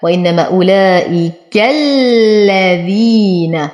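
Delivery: أولئك الذين slow, fatha on the كاف without stretching; correct tajweed demo. Shown as a demonstration. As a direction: say أولئك الذين cleanly and slowly. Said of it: "فلا أقول أولئك". (نطق صحيح بطيء بلا تمطيط)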